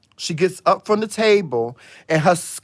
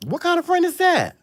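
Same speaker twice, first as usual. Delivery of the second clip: the same question much higher, held out; high incredulous cry. Retold or imitated. Asking the audience about the friend, a high incredulous cry. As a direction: squeal the question, disbelieving.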